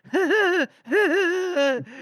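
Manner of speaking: high, loud put-on chuckle; mock husband's forced high chuckling; imitated chuckle. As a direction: chuckle along, high and exaggerated. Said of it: "he's chuckling kindly, he's kinda like".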